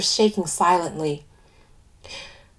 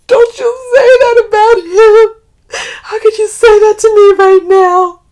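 very loud, high wail of outrage; distraught outburst; 14 semitones higher, 14 dB louder than usual. A narrator's reading. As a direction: cry out in outraged hurt. Distraught friend's outraged retort in story.

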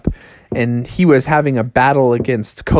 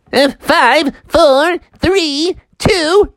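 high countdown climbing number by number; rabbit countdown; 13 semitones higher, much slower than usual. Acted. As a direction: count down in a high, rising character voice. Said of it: "the rabbit was like".

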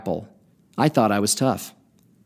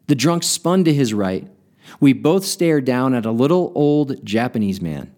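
usual speaking voice

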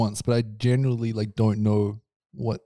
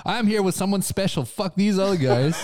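mocking impression; 7 semitones higher than usual. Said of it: "he's like".

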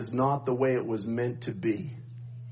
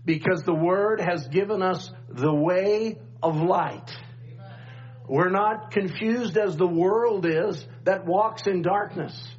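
preaching; 6 semitones higher than usual. Acted. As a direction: preach it with rising fire. Rising preaching at full force.

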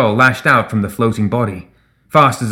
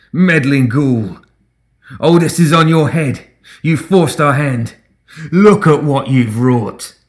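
loud, high and slow, raging; furious tirade; 5 semitones higher, 4 dB louder, slower than usual. A narrator's reading. Simeon blaming Reva for the carnage, furious. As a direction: hurl every word like fire.

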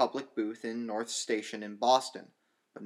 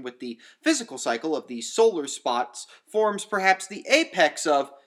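higher, louder lecturing professor; resuming his lecture; 4 semitones higher, 5 dB louder than usual. Acted. Professor resuming his lecture to the economics class, raised and higher.

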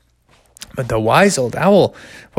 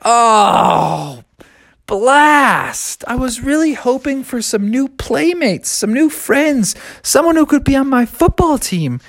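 put-on disappointed kid; 10 semitones higher than usual. Child disappointed.

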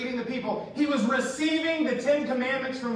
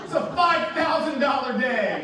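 higher, louder mock outrage; exasperated parent; 5 semitones higher, 3 dB louder than usual. Parent answering the whining kid, mock exasperated. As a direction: protest like a parent who paid dearly.

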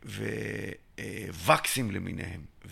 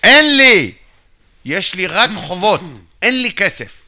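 loud, high, exasperated shout; 9 semitones higher, 18 dB louder than usual. Retold or imitated. To the treasurer, giving up on explaining: loud exasperated shout. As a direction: throw up your hands and yell it.

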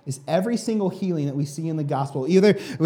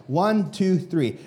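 ordinary talk

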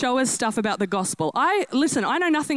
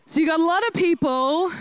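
shouting; 5 semitones higher than usual. Real, not acted. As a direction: blast it out to the crowd. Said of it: "I know, I'm starting strong".

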